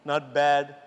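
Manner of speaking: imitating a low, dull "Not bad."; mock people's low "Not bad."; glum imitation. Teacher imitating people, low dull "Not bad.". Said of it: "Have you heard people saying".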